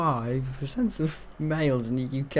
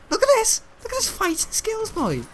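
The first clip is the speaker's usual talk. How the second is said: excited squeal. Real, not acted. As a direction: show off the moves with a squeal. Showing off in-game.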